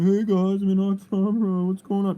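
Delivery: high, loud hammed-up streamer greeting; mock streamer. Mocking a tourist streamer greeting viewers, loud and high. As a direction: ham it up like an annoying livestreamer. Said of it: "streaming or anything, going".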